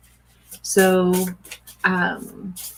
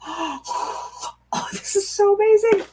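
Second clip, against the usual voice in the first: breathless oh, then higher amazed words; hushed gasp rising into wonder; awed; 12 semitones higher, slower than usual. Real, not acted. Reader turning the baby card, awed gasp then higher praise. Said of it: "I got tears".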